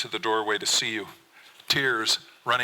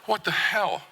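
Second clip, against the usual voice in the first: normal talking voice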